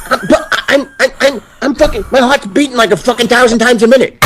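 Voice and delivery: high, shaken, laughing recruit voice; panicked Jimmy. Retold or imitated. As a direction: gasp it out like he nearly died. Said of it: "he's like".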